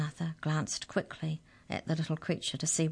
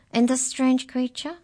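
high, louder canine character voice; canine leader; 8 semitones higher, 5 dB louder than usual. Acted. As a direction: voice a questioning canine, pitched up and loud. Canine leader questions the newcomer.

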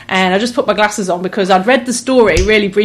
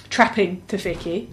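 ordinary talk, nothing marked

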